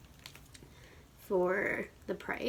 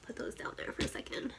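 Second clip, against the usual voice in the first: whisper; 3 semitones higher, 7 dB quieter, faster than usual. Real, not acted. Aside while unboxing.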